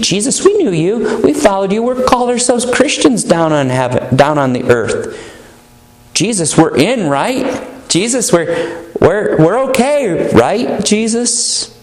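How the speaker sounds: high, pleading voice of a self-assured believer; pleading churchgoer. Retold or imitated. Claimants pleading with Jesus, voiced.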